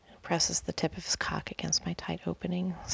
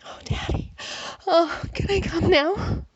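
higher and louder; 9 semitones higher, 7 dB louder than usual. A narrator's reading. She begs Daddy on the edge, high and loud. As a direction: climb up high and loud, begging. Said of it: "My voice gets higher and louder".

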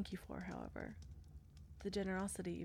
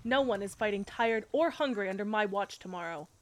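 commanding; 3 semitones higher, 8 dB louder than usual. Acted. Knight commanding her troops.

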